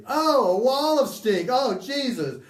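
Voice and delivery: loud, high sneering jeers; raging insults. Retold or imitated. Beloved jeers at approaching gunmen, loud and high. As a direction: blast the insults as they come closer. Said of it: "Beloved's insults rage".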